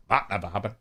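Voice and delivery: quiet mocking aside in imitation; lightly put-on scoffer's voice; Morik. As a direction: drop it softly as a snide dig. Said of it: "in his voice".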